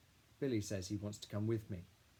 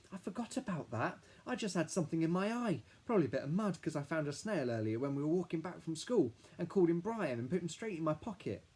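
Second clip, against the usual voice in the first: put-on high, louder eager kid voice rattling on; chatty little boy; 8 semitones higher, 4 dB louder than usual. A narrator's reading. Billy explaining the snail to his sister, high eager kid voice. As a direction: babble happily like a small boy about his snail.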